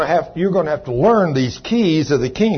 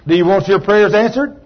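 ordinary talk

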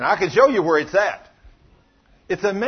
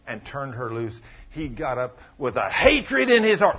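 ordinary delivery

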